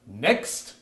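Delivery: ordinary delivery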